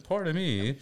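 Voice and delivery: mock-polite request with a pinky up; prim, polite child. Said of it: "hold his pinky up".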